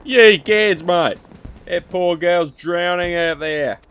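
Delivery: loud hammed-up Aussie exclamations, mate; mock Aussie shout. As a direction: shout it like a panicked Aussie NPC. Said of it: "I can't hold an accent".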